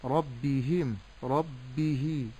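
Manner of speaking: ربهم، ربه with a dragged hee; lengthened hā. Shown as a demonstration. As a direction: overstretch the final i of ربهم، ربه. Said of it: "مددت الهاء". («ربهم، ربه» بمدّ الهاء)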